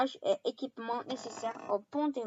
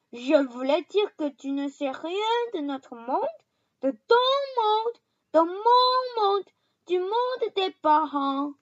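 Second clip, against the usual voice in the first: louder, higher insistent; heated giant; 8 semitones higher, 7 dB louder than usual. A narrator's reading. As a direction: press it home, slowing. Hagrid explaining heatedly.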